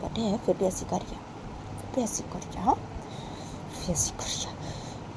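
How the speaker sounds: whispered, seething under the breath; soft furious muttering; angry whisper. Acted. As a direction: hiss the complaint quietly. Bani seething under her breath.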